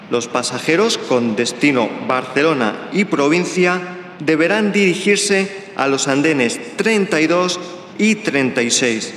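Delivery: ordinary talk, nothing marked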